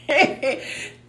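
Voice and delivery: high delighted laugh; giddy real laughter; excited laugh. Real, not acted. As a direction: laugh out loud, carried away. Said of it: "I was getting beside myself".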